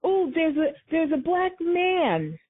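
a touch of a prissy caller; mock caller. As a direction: imitate a fussy neighbour reporting. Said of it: "call up and say".

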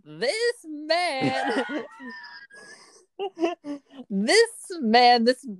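high, slow hammed-up sermon; drawn-out, high-pitched mock testimony, breaking into laughter. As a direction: proclaim it like a gushing mock preacher. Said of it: "sermon about why Tom Holland deserves".

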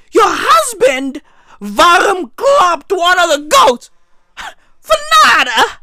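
high, squealing indignant protest; hammed-up outrage. Same line repeated in outrage.

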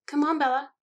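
high, loud, cajoling call; coaxing. A narrator's reading. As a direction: coax a hesitant friend along. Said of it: "Alice coaxed".

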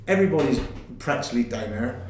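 ordinary talk

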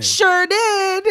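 high, loud laughter breaking into the words; can't stop laughing; laughing hard. Real, not acted. Telling her cohost she named him, laughing hard. Cracking up over the story.

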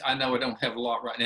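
normal talking voice

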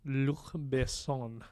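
slow, careful French Luc Besson; French accent. Shown as a demonstration. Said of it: "really terrible French accent".

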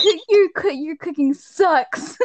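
stumble, then sneer the insult out slowly; mock rude insult. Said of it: "Robert Pattin's like".

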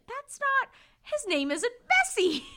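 very high, slow mock protest; indignant Grover. Grover protesting the name, high mock squeak. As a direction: squeak out a comic objection.

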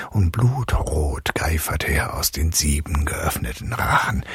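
ordinary delivery

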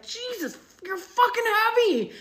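high, sharp cry of pain and irritation; angry outburst. Shown as a demonstration. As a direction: snap it like the pig just crushed your toe. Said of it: "just like that".

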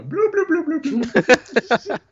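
high, bubbly bloop sounds; silly bloop noise. Retold or imitated. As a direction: bloop it out playfully. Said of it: "the noise that Peyton gave her".

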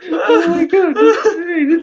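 cracking up; laughing. Real, not acted. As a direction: gasp it out mid-laugh. Laughing hard.

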